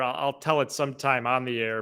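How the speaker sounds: normal talking voice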